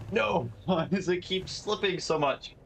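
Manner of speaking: high-pitched wail of frustration; exasperated high complaint, drawn out; dismayed outcry. Real, not acted. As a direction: cry out as it slips away. Game goes wrong, dismayed outcry.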